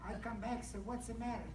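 usual speaking voice

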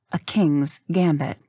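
ordinary delivery, nothing marked

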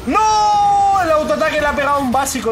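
long, high, loud cry of "no"; dismayed yell. Real, not acted. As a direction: cry out at the bad luck, staying loud and high. Dismay at losing in game.

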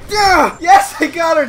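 high, loud cheer; triumphant shout. Real, not acted. Player finally killing Phasma, triumphant shout. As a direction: yell in triumph. Kills enemy.

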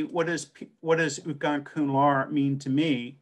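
ordinary delivery

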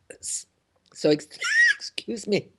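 choking on words, half whispered; frog in throat. Real, not acted. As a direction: clear a choked-up throat mid-word. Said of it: "Got a frog in my throat".

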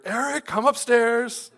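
high, slow, loud call from upstairs; a mother summoning her son, drawn-out high call; mom calling out. Retold or imitated. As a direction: call the boy's name up the stairs like a mother.

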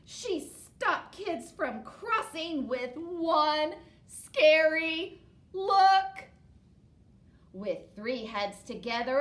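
put-on lively read-aloud for children; storybook reading. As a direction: tell it to the kids, bright and steady. Reads picture book aloud.

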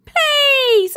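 high, loud pleading; begging. A narrator's reading. Trapped boy begs the teacher, pleading. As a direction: beg desperately.

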